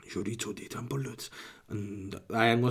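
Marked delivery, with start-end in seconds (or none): 0.1-1.3 very soft mock-Spanish product name; Spanish voiceover accent. Would-be voiceover guy ending his routine, soft Spanish accent. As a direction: murmur it in put-on Spanish. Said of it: "I should just be a Spanish voiceover guy".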